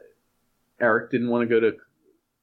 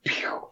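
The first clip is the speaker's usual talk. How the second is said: soft unvoiced pew of a gun; whispered gunshot; 5 dB quieter than usual. Classmate miming a shot in class, whispered. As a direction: breathe out a tiny fake gunshot. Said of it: "And he would go".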